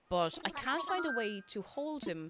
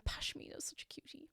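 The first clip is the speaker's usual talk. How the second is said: soft whisper; 10 dB quieter than usual. Real, not acted. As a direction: whisper it fondly. Unvoiced whisper.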